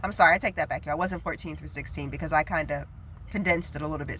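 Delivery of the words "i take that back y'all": ordinary talk, nothing marked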